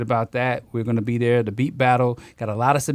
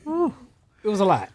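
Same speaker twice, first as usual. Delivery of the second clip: wry understatement; 9 semitones higher, slower than usual. Real, not acted. Reaction to the clip.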